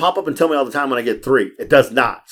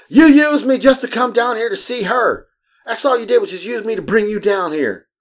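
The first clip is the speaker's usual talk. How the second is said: imitated yelling; 10 semitones higher than usual. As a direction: act out her accusing yell, pitched way up. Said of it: "I hear the girl yelling at her".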